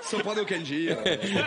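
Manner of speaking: low, laughing [name] imitation; [name] impression. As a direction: une imitation de [name] en riant. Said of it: "tu imites bien [name]".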